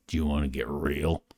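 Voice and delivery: low, mock serious voice; deep voice. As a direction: drop into a deep voice.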